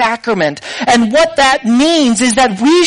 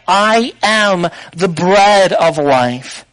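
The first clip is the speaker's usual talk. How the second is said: slow solemn declaration; 3 semitones lower, much slower than usual. Acted. Quoting Jesus, solemn.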